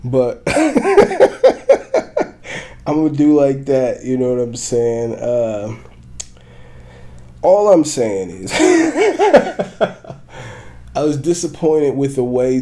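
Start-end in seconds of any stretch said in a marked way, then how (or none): 0.5-2.8 high, loud burst of laughter; long high-pitched laughing fit; real laughter. Real, not acted. Laughs at own accent. 8.6-10.9 high laughing burst; high laugh that tails off; real laughter. Real, not acted. Cracks up mid-sentence.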